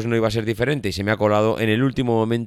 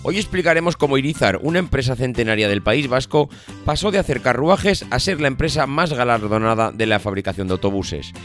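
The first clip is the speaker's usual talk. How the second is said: upbeat host intro, higher pitched; podcast intro; 4 semitones higher than usual. Acted. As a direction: welcome listeners like a radio announcer. Podcast intro over music.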